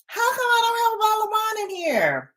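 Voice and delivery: high, fast mock complaint; mock outrage. Playing herself finding no wine, mock outrage. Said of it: "be like".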